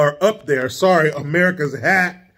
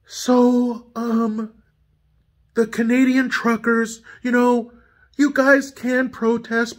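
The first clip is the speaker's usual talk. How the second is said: higher-pitched, halting mock politician; Justin Trudeau; 5 semitones higher than usual. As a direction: impersonate a prim politician in a higher voice. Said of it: "let me do my best to do Justin".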